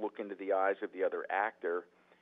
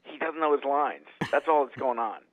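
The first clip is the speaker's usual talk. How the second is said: louder, higher, spelling it out; insistent repeat, louder; 5 semitones higher, 8 dB louder than usual. Retold or imitated. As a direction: say it again so he finally hears. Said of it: "He goes".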